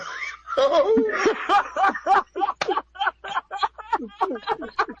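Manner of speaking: high burst of laughter; squealing laugh. Real, not acted. Laughing at frozen phone.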